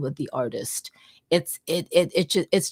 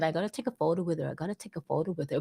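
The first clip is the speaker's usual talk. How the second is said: hushed inner thought; 8 dB quieter, much faster than usual. Retold or imitated. Inner thought retold, hurried.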